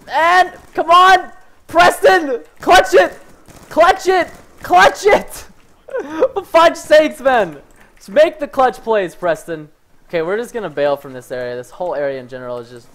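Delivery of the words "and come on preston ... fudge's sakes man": high, loud self-coaching shouts; desperate urging. Real, not acted. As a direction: yell at yourself to pull it off. Urges self to clutch.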